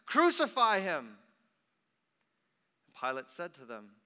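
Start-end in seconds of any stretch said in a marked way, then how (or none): 0.0-1.2 high, very loud repeated shouts; crowd crying out. A narrator's reading. Crowd rejecting their king before Pilate, loud outcry. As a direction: bellow it like a mob. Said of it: "they cried out".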